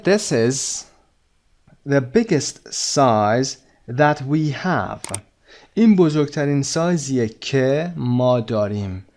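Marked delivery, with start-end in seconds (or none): 0.0-5.2 very slow, carefully spaced words; slow model reading. Shown as a demonstration. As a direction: read it out slowly for learners. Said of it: "teacher reads answer slowly".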